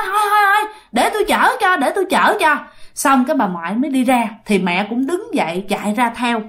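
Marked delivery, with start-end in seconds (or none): 0.1-2.6 high-pitched appeasing interruption; acted conciliatory "all right, all right"; hurriedly placating. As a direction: give in so the customer isn't offended. Grandmother placates an offended customer.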